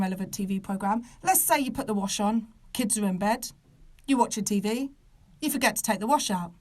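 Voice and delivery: ordinary talk, nothing marked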